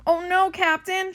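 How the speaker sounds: high, loud, stretched call; alarmed shout. A narrator's reading. First mate warning the captain of a storm, high shout. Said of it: "shouts First Mate Callie".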